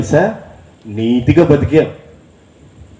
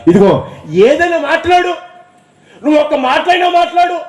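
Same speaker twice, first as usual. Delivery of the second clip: urgent plea pitched much higher; Pilate begging. Retold or imitated. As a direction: beg him to speak, rising high. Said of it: "ఆ పిలాతు అడుగుతున్నాడంట".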